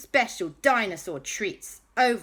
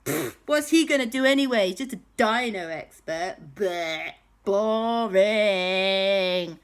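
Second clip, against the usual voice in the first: higher, louder dismissive sneer; scornful villain; 4 semitones higher, 4 dB louder than usual. Acted. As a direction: scoff as a bratty villain. Villain scoffing in a told story.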